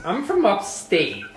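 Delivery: put-on high, slow drawling accent; diner waitress. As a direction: play a small-town diner waitress. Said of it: "I hear the accent".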